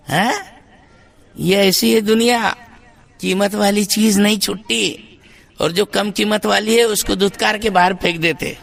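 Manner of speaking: ordinary talk, nothing marked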